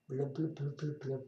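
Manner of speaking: soft, low bubbly blubs; fish bubbling. Pretend. Reader imitating fish air bubbles, blubbing softly. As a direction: blub softly like bubbles underwater. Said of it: "Can fish talk?".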